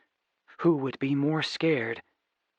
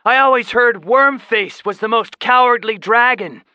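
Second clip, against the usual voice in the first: loud, high mocking yell; boy yelling an insult; taunting yell; 10 semitones higher, 7 dB louder than usual. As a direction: provoke the dragon with scorn. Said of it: "Seth yelled".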